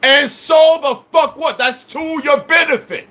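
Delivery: high, drawn-out emphatic shout; real exasperated outburst; angry retort. Real, not acted. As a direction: throw it back at them forcefully, slowly.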